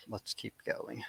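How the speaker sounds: soft, half-whispered reply; hushed deferral. Real, not acted. Co-host putting off his question, hushed. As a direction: say it under the breath, nearly voiceless.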